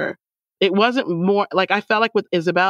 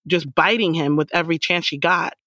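ordinary delivery

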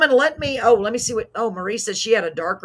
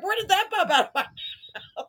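laughing; 10 semitones higher than usual. Retold or imitated. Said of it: "I'm like".

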